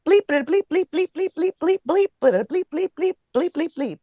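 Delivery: high, rapid-fire string of censor bleeps; comic stand-in for furious swearing; mock angry rant. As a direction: act out a cursing tirade in high bleeps. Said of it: "that's how angry I was".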